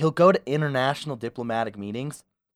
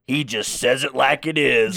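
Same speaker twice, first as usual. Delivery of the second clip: mock Southern accent. Podcaster mimics Trump's support base, mock Southern accent. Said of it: "you use that Southern accent".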